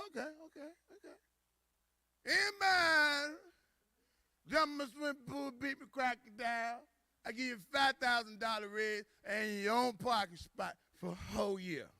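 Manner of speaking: acted slurring company president; drunk boss. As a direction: raise the stakes like a drunken host. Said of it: "He got drunk, started making deals".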